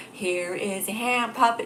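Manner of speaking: slow, drawn-out puppet presenter; gasp, then slow puppet-show voice. Pretend. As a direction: introduce the puppet slowly in a put-on voice. Introducing a hand puppet act.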